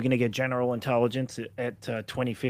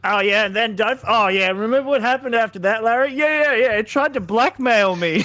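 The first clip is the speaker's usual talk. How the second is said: higher, animated acted-out chatter; mock breezy report; 6 semitones higher, 3 dB louder than usual. Imagined developer telling Larry about AI blackmail, mock breezy. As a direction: play alarming news as cheerful gossip.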